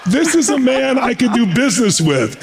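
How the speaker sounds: high, emphatic retold verdict; imitated Gorbachev. Leader approving of his rival after their argument, imitated verdict. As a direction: declare it high, imitating a won-over statesman. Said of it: "He goes".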